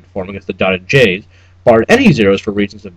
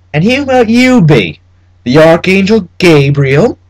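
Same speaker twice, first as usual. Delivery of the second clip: high, slow, mocking old-West drawl; a petty clerk sneering at a stranger; a sneering frontier clerk's voice, higher and slow; 7 semitones higher, 4 dB louder, slower than usual.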